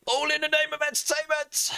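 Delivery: high mock-grand declaration; high theatrical voice. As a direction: sing it out like a hero. Mock heroic to Rosie.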